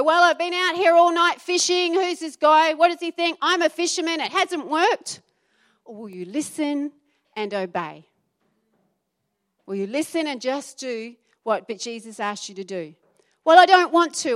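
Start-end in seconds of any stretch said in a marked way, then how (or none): 0.0-5.2 loud, high, scoffing retort; exasperated grumble of a fisherman up all night; indignant fisherman. Acted. Doubting fisherman argues back. 13.4-14.3 reluctant whine. Acted. Reluctant listener's excuse.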